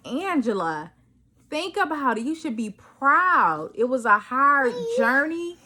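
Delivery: choked-up self-encouragement; tearful pride. Real, not acted. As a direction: talk to yourself, moved and proud. Emotional pep talk to herself.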